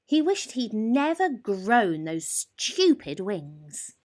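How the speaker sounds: ordinary delivery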